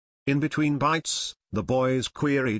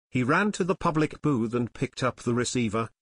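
ordinary delivery, nothing marked

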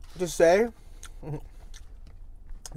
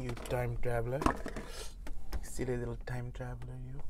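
tender cooing; 6 semitones lower, 10 dB quieter than usual. Real, not acted. Cooing at the sandwich.

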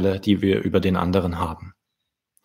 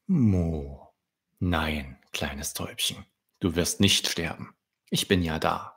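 soft, low reassuring voice; comforting; 4 semitones lower, 6 dB quieter than usual. Acted. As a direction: soothe the frightened little dove. Cow queen comforts the dove.

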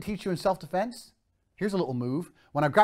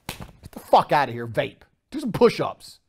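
ordinary talk, nothing marked

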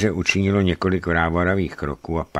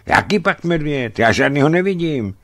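impatient; 9 semitones higher, 4 dB louder than usual. A narrator's reading. Narrator answers the panicked hunter.

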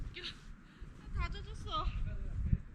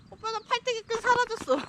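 higher, loud call; 6 semitones higher, 8 dB louder than usual. Real, not acted. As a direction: shout it across the slope. Calling to her partner.

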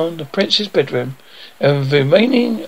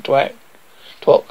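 plain reading voice

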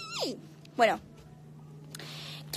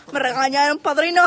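loud complaint; 3 semitones higher, 9 dB louder than usual. Real, not acted. Complaining loudly to godfather.

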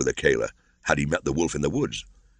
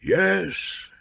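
baying. A narrator's reading. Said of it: "the wolves bayed".

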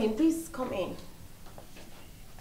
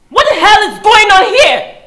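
furious scream; 10 semitones higher, 18 dB louder than usual. Acted. Wife discovers cheating, screams.

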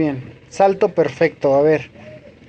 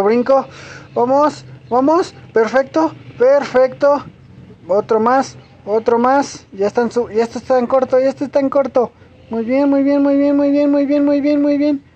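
excited streak; 6 semitones higher than usual. Real, not acted. Gamer chaining jumps, excited self-cheering. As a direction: hype yourself up through the run.

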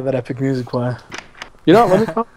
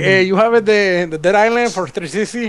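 mock Mexican accent; 5 semitones higher than usual. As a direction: ask the clerk in a hammed-up Mexican accent. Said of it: "with a Mexican accent".

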